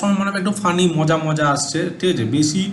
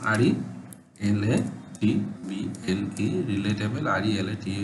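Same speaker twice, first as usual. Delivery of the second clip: spelling out letters; 6 semitones lower, 9 dB quieter than usual. Shown as a demonstration.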